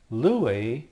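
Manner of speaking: teacher modelling "lue"; careful Greek model word; Greek verb form demo. Shown as a demonstration. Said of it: "and also".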